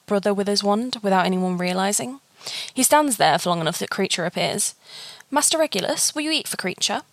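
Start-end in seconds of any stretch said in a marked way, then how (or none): 5.3-7.0 high house-elf voice. Acted. House-elf Kreacher pleads with master.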